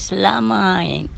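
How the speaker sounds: "Selamat" drawn out slowly, falling; slow flat selamat. Shown as a demonstration. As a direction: stretch "Selamat" slow and level. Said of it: "Tetapi bunyi dia macam mana?". ("Selamat" disebut perlahan dan meleret)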